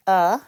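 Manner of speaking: "uh" as a loud, isolated schwa; syllable demonstration. Shown as a demonstration. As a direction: sound out "uh" firmly on its own. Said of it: "let me give you the pronunciation".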